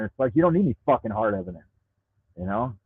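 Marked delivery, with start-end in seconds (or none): none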